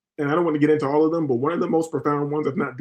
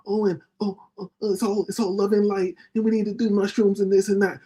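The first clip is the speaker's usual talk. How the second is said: mock love-and-light believer; 5 semitones higher than usual. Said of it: "a lot of people believe".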